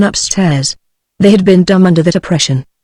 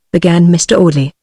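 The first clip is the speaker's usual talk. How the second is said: ordinary delivery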